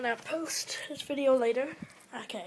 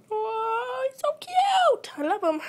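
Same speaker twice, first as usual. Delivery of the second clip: high cute squeal; 13 semitones higher, 6 dB louder than usual. Real, not acted. Squealing over her new pet hamster. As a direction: squeal it, much higher and louder, and stretch it slowly.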